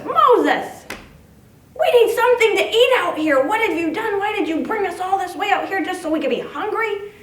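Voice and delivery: high, loud whiny grumbling; hungry crowd griping at their leader, high and loud; grumpy complaining. Acted. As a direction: whine at Moses like hungry grumps. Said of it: "they're grumbly and they're grumpy".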